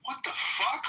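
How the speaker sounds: high-pitched retold bewilderment; jolted out of sleep, voice shooting up; startled awake. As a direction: act out waking in shocked confusion. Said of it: "And I wake up".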